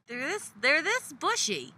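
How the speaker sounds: squeaky high pitch. Acted. Exaggerated brow description.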